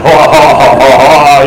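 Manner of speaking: retold spooky laugh, boomed out lightly; booming spooky laugh. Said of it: "There's this scary voice booming".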